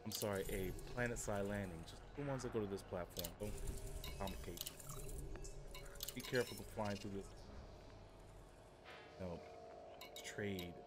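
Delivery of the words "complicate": ordinary talk, nothing marked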